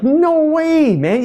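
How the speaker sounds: high, exclaimed mock astonishment; retold disbelief. Speaker reacting to photographer's eight-frame claim, acted disbelief.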